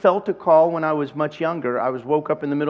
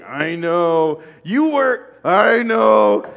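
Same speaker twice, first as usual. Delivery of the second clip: hammed-up sheepish reply; 2 semitones higher, 6 dB louder, much slower than usual. Sheepish admission, acted out loudly.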